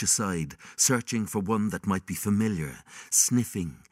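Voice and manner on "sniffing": ordinary delivery, nothing marked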